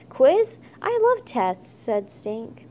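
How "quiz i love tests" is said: excited. A narrator's reading. Stink thrilled about a quiz.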